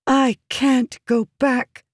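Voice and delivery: high, slow, intense refusal. A narrator's reading. Woman refusing to return to the king, intense. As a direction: weigh each word with high-pitched intensity. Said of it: "she said intensely".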